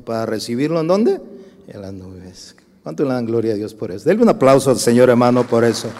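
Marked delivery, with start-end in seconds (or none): 4.1-5.9 loud, fast call for applause. Real, not acted. Preacher urging congregation to applaud, loudly. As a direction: rouse the church to clap. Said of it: "calling congregation to applaud".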